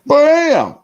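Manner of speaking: high, loud "bam" for a gunshot; exclaimed sound effect. Retold or imitated. Retelling a shooting scene to viewers, loud exclamation. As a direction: punch it out like a shot going off.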